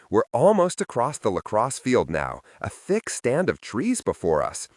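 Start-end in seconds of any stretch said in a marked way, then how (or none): none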